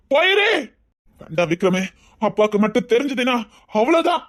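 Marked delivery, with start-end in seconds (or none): 0.1-0.5 much higher, rising command to go; sharp plea. Acted. Girl cries out to the boy to go away. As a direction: push him away with a cry. Sending him away, repeated.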